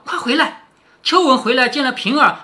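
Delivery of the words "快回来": higher-pitched, quick calling out; hurried call. A narrator's reading. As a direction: call someone back in a hurry, mildly. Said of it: "平儿忙叫".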